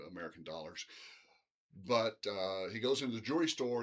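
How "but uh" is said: ordinary talk, nothing marked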